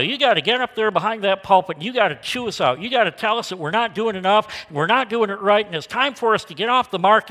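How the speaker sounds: retold urgent plea. Retelling parishioner's plea to pastor.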